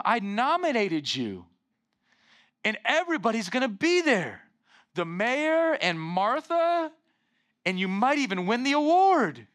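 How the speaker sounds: lightly voiced Cindy Lou inviting the Grinch; eager little girl. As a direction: coax him like an excited little girl. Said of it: "she begins to invite him".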